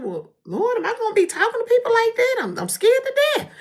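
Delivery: high, loud, fast frightened plea; scared plea to the Lord. Retold or imitated. Telling the Lord she is scared, high and loud. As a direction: rush it out high and loud, half laughing. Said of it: "I was like".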